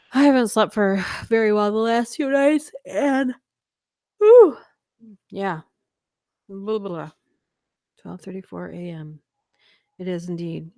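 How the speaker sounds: plain reading voice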